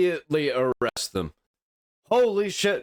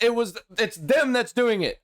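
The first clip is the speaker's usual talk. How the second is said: normal talking voice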